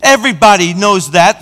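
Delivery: normal talking voice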